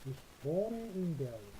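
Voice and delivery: ich wohne in Berlin said extremely slowly; slow it right down for the class; dictation pace. Shown as a demonstration. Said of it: "그리고".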